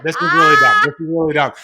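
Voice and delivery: self-mocking realization. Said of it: "I had one of those moments where I'm like".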